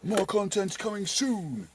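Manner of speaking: high, slow hammed-up announcer; mock youtuber promise. Vlogger teasing viewers about future videos, high and slow. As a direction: drag it out like a cheesy promo.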